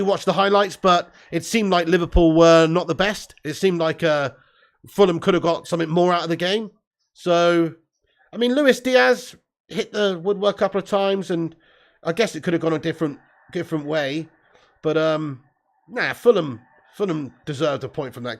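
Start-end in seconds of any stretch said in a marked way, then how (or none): none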